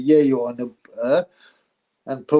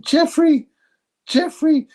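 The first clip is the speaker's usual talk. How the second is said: high, loud call of the friend's name; 5 dB louder, slower than usual. Retold or imitated. To his friend in the pub, a loud high call. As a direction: call his name twice, louder and higher.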